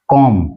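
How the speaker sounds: कॉम ending on M; कॉम closed on a plain "m"; silent B. Shown as a demonstration. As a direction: drop the B from कॉम. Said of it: "बी इसके अंदर साइलेंट है".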